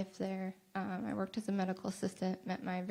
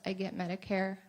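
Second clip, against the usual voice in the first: ordinary talk, nothing marked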